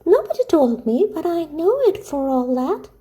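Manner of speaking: put-on high-pitched, pleading child's voice; earnest little Heidi. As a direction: speak as a worried little girl, pitched well up.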